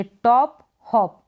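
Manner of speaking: टॉप हॉप said slowly with a short ओ sound; drawn out slowly, short ओ in टॉप and हॉप; slow pronunciation demo. Shown as a demonstration. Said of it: "आप इसको टॉप ऐसे नहीं पढ़ेंगे". (टॉप हॉप को धीरे से, छोटे ओ के साथ)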